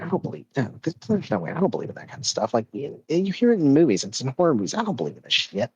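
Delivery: lightly voiced skeptical husband, brushing it off; dismissive disbelief. Husband waving off his wife's haunted toy fear, mildly dismissive.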